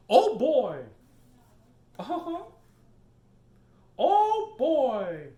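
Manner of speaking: high, loud squeaky cartoon mouse; thrilled high-pitched cartoon mouse shout; Mickey Mouse. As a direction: chirp it like a giddy cartoon mouse. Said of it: "That's a Mickey Mouse".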